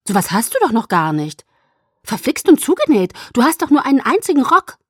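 high, loud exasperated protest; indignant outburst, building in pitch and volume. A narrator's reading. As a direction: scold in rising exasperation. Alice scolding, misunderstanding the whisper.

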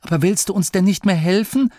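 high, fast, startled question; dismayed outburst. Acted. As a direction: blurt it out in dismay. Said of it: "entfuhr es Masklin". (bestürzt herausplatzend)

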